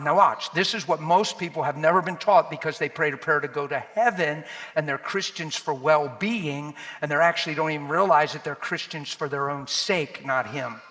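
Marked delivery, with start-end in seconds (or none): none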